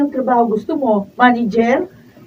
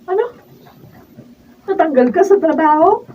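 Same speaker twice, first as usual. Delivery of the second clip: shocked question; 6 semitones higher, 7 dB louder than usual. Acted. Wife shocked he lost job.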